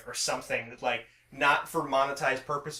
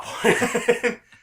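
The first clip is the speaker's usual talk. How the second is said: bursting laugh; big loud laugh; 10 semitones higher, 10 dB louder than usual. Real, not acted. Laughs at own story.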